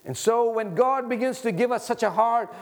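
ordinary delivery

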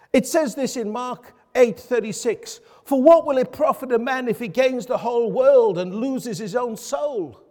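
firm, emphatic pulpit delivery; preaching. Real, not acted. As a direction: preach it out to the congregation. Sermon at full preaching force.

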